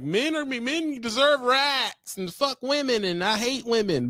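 high, drawn-out mocking rant; mock online ranter. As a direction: mimic a whiny men's-rights tirade. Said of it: "get on here and be like".

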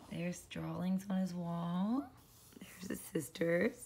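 hushed whisper to the listener. Real, not acted. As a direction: whisper like sharing a secret. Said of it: "whispering about the sisters' plan".

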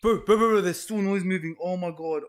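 loud, high burst of panic; alarmed. Real, not acted. Player hears noise still moving, loud panic. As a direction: blurt it out in alarm.

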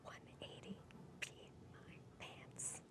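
very soft game whisper; hushed, voiceless mouthing. Pretend. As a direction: whisper each word separately. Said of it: "I'll whisper to you".

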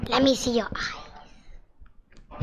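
soft slow whisper. Real, not acted. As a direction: breathe it slowly, almost silent. Leaning close, peering at eyes.

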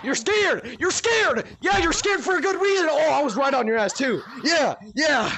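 high, loud jeering; gloating taunt. Real, not acted. Taunting a panicking player in tag, gloating shout. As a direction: rub it in at full voice.

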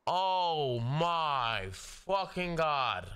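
usual speaking voice

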